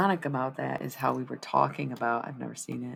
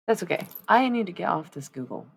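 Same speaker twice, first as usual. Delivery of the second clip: ordinary delivery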